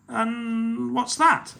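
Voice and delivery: very slow, high question; troll asking what pirates eat; curious troll. Acted. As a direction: draw out the question.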